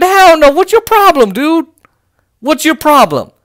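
high, loud mock indignation; high-pitched, incredulous scolding; indignant refusal. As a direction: act out an outraged rebuff. Voices Supreme Court's outraged refusal.

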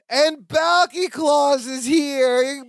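high, drawn-out announcement; grand announcement. Real, not acted. Host announces the costumed arrival, high and drawn out. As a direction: herald a big entrance, slowly. Announcing a character's big entrance.

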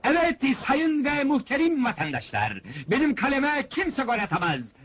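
high, proclaiming showman's pitch; high announcer voice, name drawn out at the end; barker's boast. Acted. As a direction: hype the famous goalkeeper to the crowd. Fairground barker boasts for goalkeeper.

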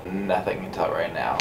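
drops to a whisper. Real, not acted. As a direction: breathe it out, barely voiced. Drowsy patient trailing off.

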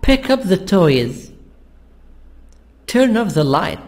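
equal weight on "Pick up", "toys", "Turn off", "light", with "the" unstressed; stress on verb, preposition, noun. Shown as a demonstration. Teacher models lines for students to repeat, stressing all but "the". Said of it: "we have the stress on the verb, preposition and the noun".

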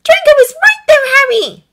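high, loud, urgent plea; desperate high-pitched appeal; pleading. A narrator's reading. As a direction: plead with a friend at the top of your voice. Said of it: "Hermione pleaded".

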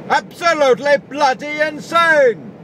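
high, loud outburst of frustration; exasperated shout. Real, not acted. Driver blurts out his annoyance at straps, loud exasperated outburst. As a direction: blow up at the flapping straps. Ranting about flapping straps.

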